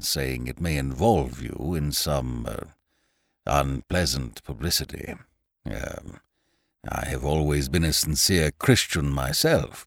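put-on low, slow, soft old lawyer; elderly lawyer voice. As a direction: speak like a careful old solicitor.

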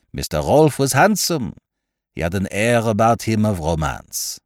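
put-on French-accented detective, knowing and emphatic; Poirot, confiding. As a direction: explain the affair in a French accent. Poirot explains the case.

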